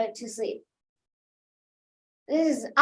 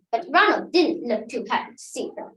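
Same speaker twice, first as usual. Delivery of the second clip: usual speaking voice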